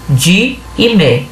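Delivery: de e mé said slowly, one syllable at a time; slow syllable count. Shown as a demonstration. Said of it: "eu tenho có". (sílabas de e mé ditas devagar, uma a uma)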